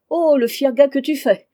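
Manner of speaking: high, loud mocking cry; exclamation moqueuse. A narrator's reading. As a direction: burst out in mock admiration. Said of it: "s'écria Vevette". (s'écrier d'un ton moqueur)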